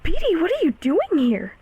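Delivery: high, loud, swooping surprise; startled surprise. Acted. Finding Petey unexpectedly outside the door, startled. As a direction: caught off guard, pitch leaping up.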